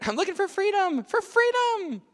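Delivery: very high voice, drawing out the second "freedom" slowly; a runaway robot pleading, high and slow. Acted. Presenter voicing a runaway robot asking for freedom, high and drawn out.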